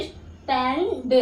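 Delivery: स्टैंड drawn out very slowly with ae; स्टैंड, slow teacher demo; slow model word. Shown as a demonstration. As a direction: spell out स्टैंड slowly for children. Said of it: "ऐ करके बोलोगे".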